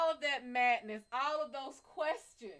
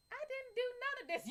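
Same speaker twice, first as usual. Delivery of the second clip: mock confused guy; 10 semitones higher, 5 dB quieter, faster than usual. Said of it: "He's gonna be like".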